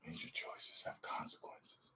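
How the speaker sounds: breathy whispered villain line; whispered impression. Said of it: "to do that impression".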